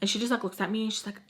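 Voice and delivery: ordinary talk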